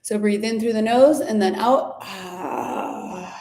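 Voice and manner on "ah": exhaled ah. Shown as a demonstration. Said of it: "If you wanna make a sound like a ah".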